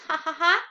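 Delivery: high, loud, deliberate ha-ha-ha; mock laugh. Shown as a demonstration. Teacher showing pupils what laughing means, mock laugh. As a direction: act out laughing with spelt-out ha-has. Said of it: "Is he laughing?".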